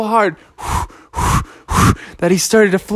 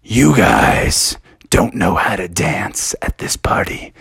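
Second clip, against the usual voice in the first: low slow whispered bird voice; hooded bird whispering to the party guests; mysterious bird; 12 semitones lower, slower than usual. Pretend. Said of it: "And the bird said".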